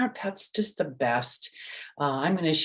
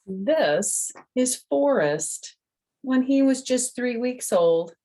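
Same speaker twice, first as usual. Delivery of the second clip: higher, louder, slow storybook lilt; reading to children; 8 semitones higher, 4 dB louder, slower than usual. A narrator's reading. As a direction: show the kids the picture with delight. Reads the picture book aloud.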